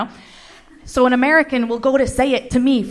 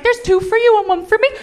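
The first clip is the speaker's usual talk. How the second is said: high, gleeful pharmacist, getting louder; partying pharmacist; 11 semitones higher, 6 dB louder than usual. Acted. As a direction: hand out treats in a giddy high voice. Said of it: "the pharmacist was just like".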